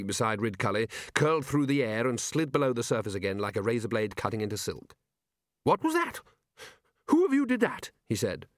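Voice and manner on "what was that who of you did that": sudden high alarmed questions; startled wizard, pitched much higher. Acted. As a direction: jump at the shape in the water. Sudden red shape from sea.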